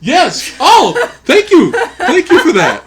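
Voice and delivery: loud, high, laughing thanks; delighted outburst. Real, not acted. Co-host names The Great Outdoors, real delighted laughing shout. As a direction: burst out laughing with excited gratitude. Delighted by co-host's film suggestion.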